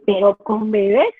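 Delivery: slow, incredulous "¿Pero con bebés?" with a rising end on "bebés"; doubtful question. Retold or imitated. Imagined parent doubting reading to babies, mildly incredulous. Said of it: "Entonces dice".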